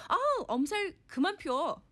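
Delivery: high, quick nagging wife voice; scolding wife. Acted. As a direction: nag him to quit whining. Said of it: "the wife version".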